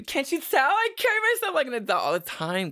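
mock-proud high voice; sarcastic high voice. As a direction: squeak it sarcastically. Sarcastic self-mocking claim.

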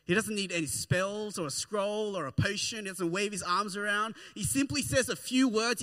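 usual speaking voice

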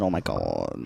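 a very low, exaggerated impression of Gina; imitating Gina's "Oh my God". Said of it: "You have said".

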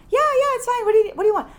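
high, loud imitation of the friend; mock brusque phone reply; mimicked impatient friend.

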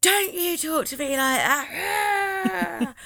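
high, slow hammed-up outrage; laugh-cracked mock telling off; mock outraged scolding. Acted row quote.

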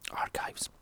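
hushed aside whisper. Real, not acted. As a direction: breathe the word as an aside. Muttered aside about the Omen.

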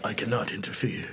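low soft Watcher imitation. Shown as a demonstration. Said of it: "you gotta do it in his voice".

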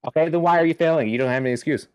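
mock mother scolding fast; scolding mom. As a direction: fire off the scolding.

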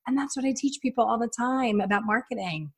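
usual speaking voice

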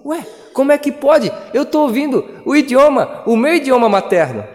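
high-pitched, astonished and delighted; amazed onlookers. Retold or imitated. Preacher voicing the witnesses astonished at Pentecost, high and amazed. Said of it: "as testemunhas ao redor ... reconheciam".

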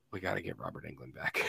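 low, muffled producer voice; mock crew voice. Producer to director, muttering about recasting. Crew wants actor back.

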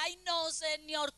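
slow, drawn-out protest; reluctant pleading. Acted. Believer resisting what the Lord orders, reluctant plea. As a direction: drag out a reluctant little whine.

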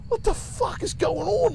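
slow, high incredulous question; bewildered. Retold or imitated. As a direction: draw it out high as a baffled onlooker. Bewildered brother in retold scene.

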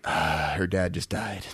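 a quiet gut-punch realization; stunned low murmur. Retold or imitated. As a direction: drop way down, slow and soft, realizing. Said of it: "Immediately, I was like".

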